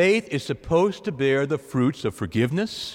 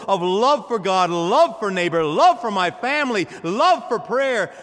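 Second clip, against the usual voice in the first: full-force preaching; 5 semitones higher than usual. Acted. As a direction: build the list like a rising sermon.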